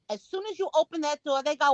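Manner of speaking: ordinary talk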